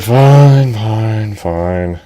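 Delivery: loud first fine fading to a mumble; grudging surrender. Acted. Edge giving in to princess, fading grudging fines. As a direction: give in, deflating each time.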